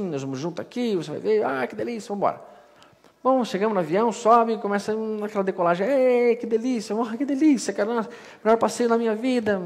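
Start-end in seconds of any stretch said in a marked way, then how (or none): 5.9-9.6 euforia exagerada. Retold or imitated. Euforia decolando no avião.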